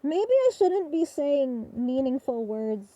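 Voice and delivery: talking through a respirator mask; words muffled behind a mask. Real, not acted. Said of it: "with the respirator on".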